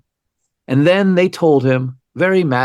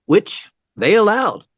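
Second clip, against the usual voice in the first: ordinary talk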